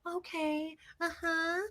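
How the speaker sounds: lightly voiced sugary assistant; sweet agreeable. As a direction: play the nice act. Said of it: "she totally flipped from".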